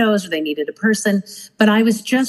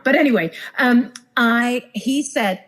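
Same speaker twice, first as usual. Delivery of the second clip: usual speaking voice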